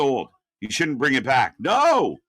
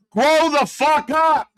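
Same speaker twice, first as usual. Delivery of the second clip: slow, much higher and louder yell; mock shout; 10 semitones higher, 6 dB louder, slower than usual. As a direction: bark it like a fed-up rival. Mocking rival's retort.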